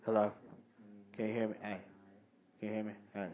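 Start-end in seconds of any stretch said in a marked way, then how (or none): none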